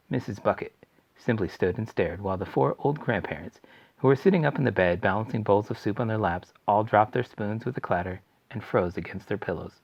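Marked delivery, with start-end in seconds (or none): none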